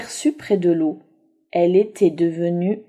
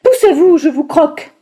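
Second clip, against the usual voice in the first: much higher, loud menacing wolf; threatening wolf; 8 semitones higher, 8 dB louder than usual. Acted. Wolf threatening to eat the animals, loud and menacing. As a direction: snarl it like a hungry wolf.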